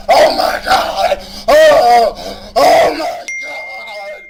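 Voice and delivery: loud, drawn-out mock horror; hammed-up appalled gasping cry; mock outrage. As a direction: wail it like a scandalized viewer. Mimics complaining viewers' overblown outrage.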